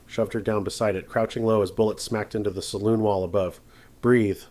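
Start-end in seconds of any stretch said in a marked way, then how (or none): 4.0-4.4 ordering. A narrator's reading. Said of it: "he ordered, voice sharp but not unkind".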